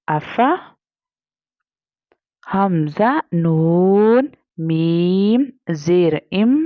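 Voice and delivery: drawn-out Quranic letter-by-letter spelling; slow spelling recitation. Shown as a demonstration. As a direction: chant each letter and vowel slowly, like a tajweed teacher. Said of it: "अगर हम हिज्जे करें तो उसको पढ़ेंगे".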